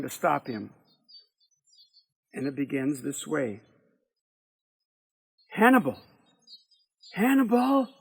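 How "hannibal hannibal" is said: high, loud call across the yard. Acted. Sarah calling Hannibal loudly from afar. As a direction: call his name to bring him back. Sarah calls after the boy.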